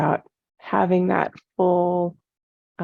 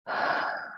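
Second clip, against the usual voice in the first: long, soft exhale; a soft, voiceless breath out; letting-go sigh; 7 dB quieter than usual. Shown as a demonstration. Teacher showing how to step back, letting-go sigh. Demonstrates letting go, giving mind break.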